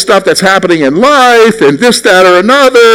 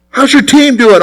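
lightly acted, higher friendly question; casual questioner; 7 semitones higher than usual. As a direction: ask like making small talk, pitched up. Acted chat with a worked-up person.

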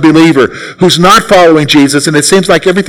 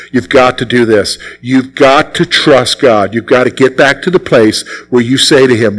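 ordinary talk, nothing marked

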